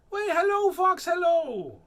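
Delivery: sing-song cheeky greeting; chirpy mouse. Acted. Mouse greeting the fox, high loud sing-song.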